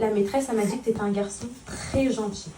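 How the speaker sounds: calm him so he listens; gentle mother talk. Real, not acted. Mother talks softly to her son about school.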